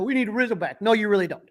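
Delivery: usual speaking voice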